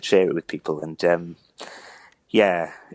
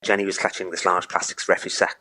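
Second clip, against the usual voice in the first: usual speaking voice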